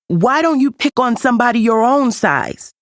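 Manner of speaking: a small cub's high, defiant challenge; bravest voice. A narrator's reading. Simba standing up to the hyenas, bravely defiant. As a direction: puff yourself up to face the bullies. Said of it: "in his bravest voice".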